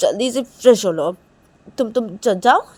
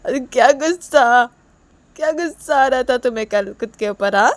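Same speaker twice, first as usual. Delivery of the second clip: acted tearful reproach; 6 semitones higher, 14 dB louder than usual. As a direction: reproach her as if weeping. Acted Reshma tearfully reproaching Kajal.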